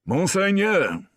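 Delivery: high, loud and slow, respectful; a sergeant announcing himself formally; formal address. A narrator's reading.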